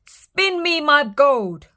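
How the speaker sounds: put-on king ordering the girl; stern order. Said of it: "ordered the king".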